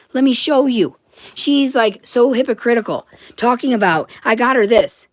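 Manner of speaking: loud, agitated, high rant; ranting, wound up and upset; a real frantic outburst, high and loud. Real, not acted. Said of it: "So yeah, I'm frantic".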